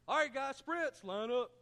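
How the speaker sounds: loud, high mock coach bark; imitating football coach. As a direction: yell it like a mumbling old coach calling sprints. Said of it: "he had a funny voice".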